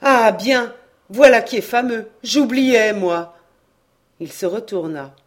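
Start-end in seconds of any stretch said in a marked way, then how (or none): 0.0-3.3 loud, higher burst of remembering; a man suddenly recalling, exclaimed loudly; sudden exclamation. A narrator's reading. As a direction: blurt it out as it hits him. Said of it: "avait poussé cette exclamation".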